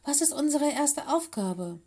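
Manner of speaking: high-pitched eager question; urging. A narrator's reading. Mino pressing to hear the first task, eager and urging. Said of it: "drängte Mino".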